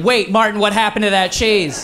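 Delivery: mock concerned fan; concerned audience voice.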